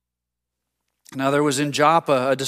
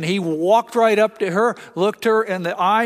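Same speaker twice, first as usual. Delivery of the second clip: ordinary talk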